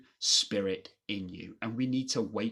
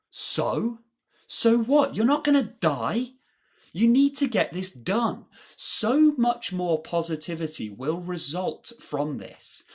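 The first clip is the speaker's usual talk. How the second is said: emphatic shout; 7 semitones higher, 6 dB louder than usual. Acted. Pushing the listener emphatically.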